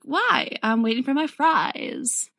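high, drawn-out protest; mock-innocent complaint, stretched out slowly; feigned innocence. Kili acts obtuse to the threat.

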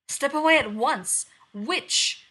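furious shout. Acted. Huntress ordering the queen away from the princess, furious shout. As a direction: drive the witch away with your voice.

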